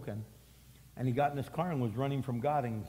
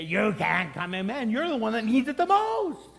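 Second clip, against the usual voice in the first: mock shout; 7 semitones higher, 6 dB louder than usual. Pastor rejecting exclusion, rising to a shout. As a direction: build it to a loud cry. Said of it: "But we're not gonna say".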